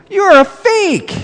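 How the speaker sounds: angry accusing. Retold or imitated. Buddy accusing Santa.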